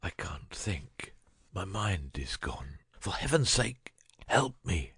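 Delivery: breathless, dying whisper; feeble whisper. Acted. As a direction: beg in a faint whisper as if dying. Dying Holmes begging in whisper.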